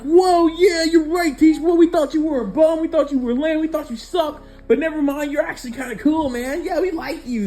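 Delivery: very high, squeaky crowd voice; a whole class sucking up to the teacher; fawning classmates. Retold or imitated. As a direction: ham up a gushing class of kids. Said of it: "everybody would go like".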